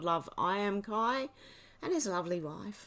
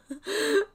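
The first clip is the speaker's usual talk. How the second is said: a short high squeal of laughter; high giggle; 14 semitones higher, 7 dB louder than usual. Real, not acted. Laughing at Timmy's pancake news, high giggle. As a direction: giggle with delight.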